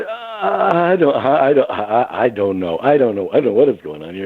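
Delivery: mock flustered, tripping over the words; flustered stammering. Suspect answering a policeman, flustered and stammering. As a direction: stammer through repeated false starts.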